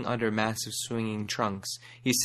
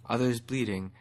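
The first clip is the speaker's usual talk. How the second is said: ordinary delivery, nothing marked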